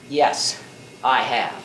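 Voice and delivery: very low, deliberate answer. Acted. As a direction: drop the pitch right down, utterly certain. Answers his own taunt, third time.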